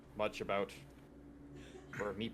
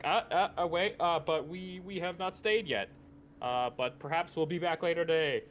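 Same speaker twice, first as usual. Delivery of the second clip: higher, stammering, placating voice; pitched-up polite dodge, hesitant at first; hasty polite excuse; 6 semitones higher, 3 dB louder than usual. Acted. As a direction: stall nervously but courteously. Acted polite excuse to innkeeper.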